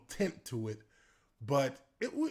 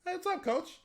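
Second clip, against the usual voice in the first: much higher, airy innocent reply. Retold or imitated. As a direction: play dumb, light and high. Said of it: "I turn my head".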